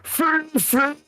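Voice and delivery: high, drawn-out comic cry; calling after Fifi. Acted. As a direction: call out for him in a squealing character voice. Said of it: "you hear".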